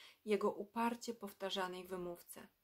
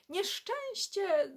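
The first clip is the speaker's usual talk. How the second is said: high, loud, drawn-out wail of alarm; a mother who just found her son's farewell note; alarmed cry; 11 semitones higher, 6 dB louder, much slower than usual. Acted. Mother cries out in panic, slow and high. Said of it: "zawołała w pośpiechu".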